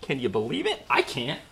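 ordinary talk, nothing marked

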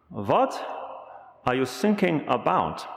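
puzzled German coast guard voice; German accent. Retold or imitated. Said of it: "those, those accents".

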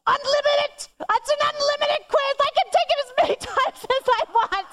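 high, squealing delight over the news; a teen girl overjoyed and laughing; thrilled daughter. Retold or imitated. Daughter discovers unlimited quiz.

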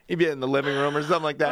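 normal talking voice

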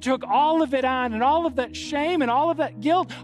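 high, loud, urgent sermon climax; impassioned preaching. Real, not acted. Preacher challenging church to turn it over to Jesus, impassioned.

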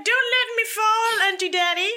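high dog voice. Pretend. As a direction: speak for the dog in a tiny high voice. Voicing the dog, high pitched.

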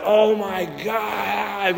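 slow, soft groan of despair; imitating distraught people, drawn out; mock dismay. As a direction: parishioners after the election, mock dismay. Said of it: "People were, like, freaking out".

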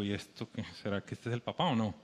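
low, soft puzzled aside; a hushed, puzzled wonder. Retold or imitated. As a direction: mutter to yourself, baffled. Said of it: "Y digo".

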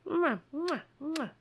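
high smacking kisses for the cat; affectionate high kissy noises; kiss sounds. Real, not acted. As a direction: blow little kisses at the pet. Kissing the cat.

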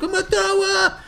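very high, loud squeal; imitating a boy's frantic cry; mock panicked yell. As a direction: shriek it like a hammed-up sick kid. Acts out sick boy yelling.

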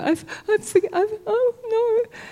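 high, stammering fluster; mock dismay. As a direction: stumble over it in a high, whimpering voice. Acts out flustered, condemned believer.

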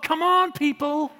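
high-pitched rallying cry; urgent exhortation. Real, not acted. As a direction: rouse the congregation. Preacher urging the congregation.

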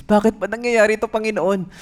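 high, loud, fast complaint; protesting refusal; complaining. Retold or imitated. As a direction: whine at God. Complaining prayer.

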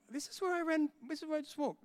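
high, rising alarm as it dawns on him; alarmed realization. Retold or imitated. Preacher retelling his panic while running through the hospital, high alarm. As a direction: voice jumping up in disbelief.

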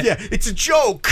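high, slow mimicked catchphrase; hammed-up TV show impression; quoted TV line. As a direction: draw out the quote, high and amused. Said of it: "It's a quote from The Sopranos".

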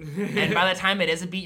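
normal talking voice

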